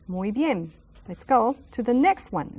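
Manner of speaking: usual speaking voice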